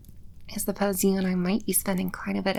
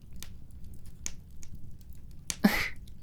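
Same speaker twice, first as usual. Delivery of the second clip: a quiet, airy demon laugh; soft breathy chuckle; 10 dB quieter than usual. Acted. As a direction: chuckle under your breath, amused.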